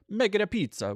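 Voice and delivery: hammed-up Italian accent, high and bright. Shown as a demonstration. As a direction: say it like a cartoon Italian chef. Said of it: "kicking into the Italian".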